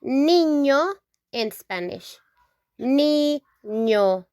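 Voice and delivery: niño with ñ like ny; teacher modelling niño; Spanish ñ. Shown as a demonstration. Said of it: "we call it".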